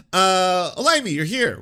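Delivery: loud, high startled hello; surprised greeting. Real, not acted. Host startled by guest arriving, loud surprised greeting. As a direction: caught off guard, call out the name.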